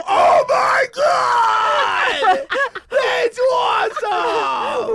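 high, loud, drawn-out shout of delight; ecstatic yell. Pretend. Man shouting in drawn-out mock joy after climax. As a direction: stretch it out, thrilled and shouting. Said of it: "you go".

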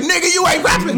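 high-pitched dismissive boss; hammed-up indignant veto; mock refusal. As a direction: shut him down, voice jumping up. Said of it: "He's like".

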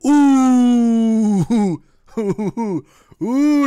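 loud, high howling like a wolf. Pretend. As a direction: throw your head back and howl. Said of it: "on fait le loup".

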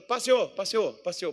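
put-on northern Minas Gerais accent greeting. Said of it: "lá no norte de Minas, o pessoal chega".

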